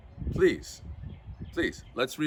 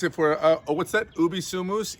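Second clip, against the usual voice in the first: usual speaking voice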